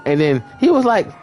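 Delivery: ordinary delivery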